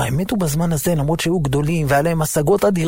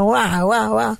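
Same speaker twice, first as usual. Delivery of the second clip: mock child wail; 6 semitones higher than usual. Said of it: "התחילה לצרוח בקולי קולות".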